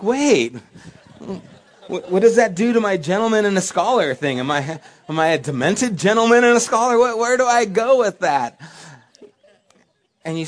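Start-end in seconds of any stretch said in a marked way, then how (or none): 0.0-8.6 high, loud comic bewilderment; mock dismay. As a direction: protest loudly as your flattered ego collapses. Deflated ego protests in comic dismay.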